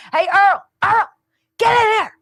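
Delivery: hammed-up shout calling Earl in, pitch jumping high; mock call out. Host acting Besson summoning a colleague, in a mock loud call. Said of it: "Here's Scott Besson. He's like".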